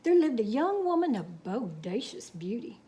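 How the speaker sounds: unhurried Texas tall-tale telling; story narration. A narrator's reading. Reading a cowboy tale to children, storytelling. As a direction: spin the yarn for the kids.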